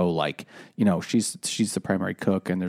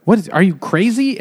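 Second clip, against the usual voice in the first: mock angry husband; 9 semitones higher, 6 dB louder than usual. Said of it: "he's like".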